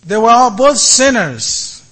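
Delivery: ordinary delivery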